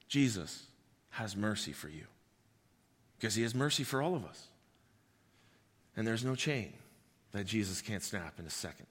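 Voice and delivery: soft, low reassuring voice; tender. Acted. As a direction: gently reassure the hurting. Tender lowered pastoral appeal.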